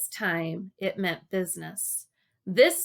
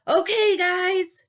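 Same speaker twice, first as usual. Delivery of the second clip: high, loud call; shouting; 11 semitones higher, 6 dB louder, much slower than usual. Acted. Dale calling to the boys from the water, loud shout. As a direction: call over the current. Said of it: "Dale shouted".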